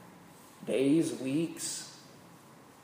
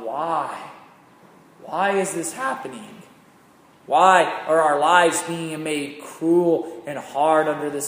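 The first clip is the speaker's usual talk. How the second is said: anguished plea; 7 dB louder than usual. Acted. Hebrews crying out to God.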